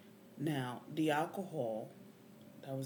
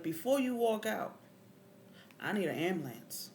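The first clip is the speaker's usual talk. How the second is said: raised, higher voice calling someone back; 7 semitones higher, 6 dB louder than usual. Retold or imitated. Arrested man calls departing officer back for ambulance, calling out. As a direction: call after him with urgency.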